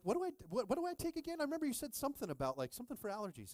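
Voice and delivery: high, fast, urgent questions; mock frantic asking. Asking his buddy what to take, high and frantic. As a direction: rattle it off high like a flustered friend. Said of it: "I'm like".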